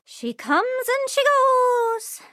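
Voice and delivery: drawn-out high singsong; slow, high and dreamy. Acted. A character musing aloud, slow and high. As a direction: stretch every word out, up high. Repeated line, second take drawn out.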